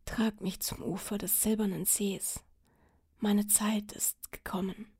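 faint whisper of a dying king. Acted. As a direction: breathe it out, barely alive. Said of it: "Sterbend rief der König".

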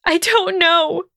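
very high, drawn-out wail; high, distressed whining; loud whine. A narrator's reading. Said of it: "Hermione whined loudly".

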